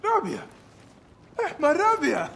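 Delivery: joyful greeting. Acted. Joyful reunion with old friend.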